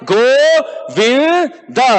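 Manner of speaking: Govinda broken into three slow, stretched pieces; laboured attempt. Shown as a demonstration. Preacher shows us trying three syllables, slow and laboured. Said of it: "But when we actually try".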